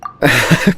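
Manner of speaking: short embarrassed laugh; bashful laugh. Acted. Kujo embarrassed by teasing about marriage, bashful laugh. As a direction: laugh it off, a little flustered. Teased about his wedding plans.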